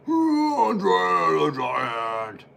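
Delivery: slow, stretched announcer call of the name; drawn-out ring announcer call. Acted. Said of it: "Oh, that hurt my throat".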